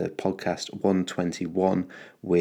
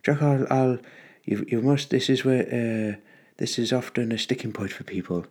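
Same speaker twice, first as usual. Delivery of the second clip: higher-pitched attempt at an Irish accent; 4 semitones higher than usual. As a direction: imitate an Irish friend's lilt, higher than usual. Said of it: "do his beautiful Irish accent".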